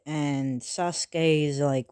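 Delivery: ordinary delivery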